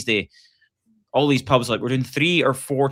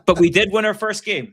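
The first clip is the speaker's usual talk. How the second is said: excited; 8 semitones higher, 5 dB louder than usual. Real, not acted. Joking comeback after fans remark.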